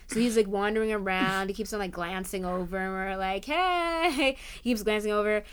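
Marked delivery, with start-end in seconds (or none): 3.5-4.4 high, drawn-out hey; a lifted, lingering mock greeting; imitated hey. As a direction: sing out a long, higher-pitched hey. Imitating his glance over.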